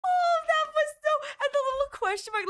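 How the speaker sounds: high, loud delighted gush; gushing squeal. Real, not acted. Streamer gushes at the scene.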